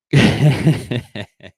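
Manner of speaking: loud burst of laughter. Real, not acted. Streamer reacting to fans in live chat, laughing.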